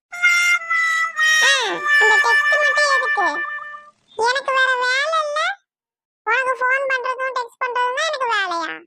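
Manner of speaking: high, worked-up retort; angry retort. Acted. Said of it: "கோவப்படாத".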